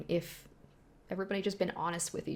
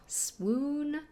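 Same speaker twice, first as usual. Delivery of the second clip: swoon; 8 semitones higher than usual. Shown as a demonstration. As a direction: swoon swooped up high. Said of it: "Like, oh".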